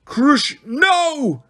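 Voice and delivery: very high, cracking scream; cracked shout. A narrator's reading. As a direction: voice breaking on the shout. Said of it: "shouted Hermione in a cracked voice".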